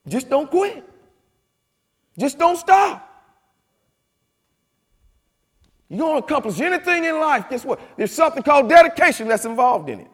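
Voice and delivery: high, loud preacher cadence; preaching. Acted. Speaker preaching dedication to the congregation at full force. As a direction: preach it to the back row.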